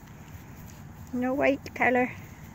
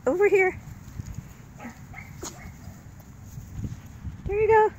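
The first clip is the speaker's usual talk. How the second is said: puppy talk; 8 semitones higher than usual. Real, not acted. Calling puppies, high.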